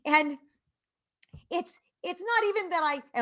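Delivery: high, loud mock-upset kid voice; imitated tearful child. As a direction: wail an apology like an overwrought child. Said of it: "she went on like that and she cried".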